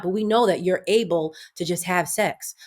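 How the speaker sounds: ordinary talk, nothing marked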